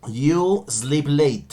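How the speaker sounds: you sleep late drawn out word by word; very slow dictation. Shown as a demonstration. Teacher reading a question aloud to students, very slow. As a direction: read it out slowly for the class to copy. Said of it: "la número nueve".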